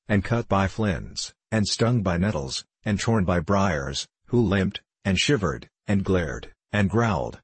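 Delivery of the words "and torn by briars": usual speaking voice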